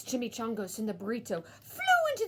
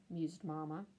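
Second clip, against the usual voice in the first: ordinary delivery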